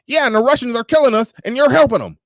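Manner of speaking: high, insistent mock rant; imitating RBR. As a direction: squeak it out like the paranoid guest. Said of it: "He was like".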